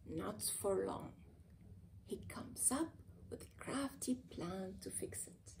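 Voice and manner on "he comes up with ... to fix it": whispered, sly narration; sneaky whispered aside; conspiratorial whisper. A narrator's reading. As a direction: let them in on a secret plan. Narrator hints at crafty plan.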